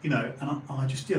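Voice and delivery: normal talking voice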